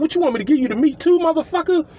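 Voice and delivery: high, fast exasperated retort; incredulous mock outrage. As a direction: fire it back in disbelief.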